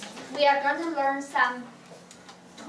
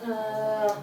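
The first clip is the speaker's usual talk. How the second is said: "uh" dropped low and level; low third tone; 4 semitones lower than usual. Shown as a demonstration. Teacher showing third tone, very low. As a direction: sink "uh" down low. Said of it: "the third tone is a very low part".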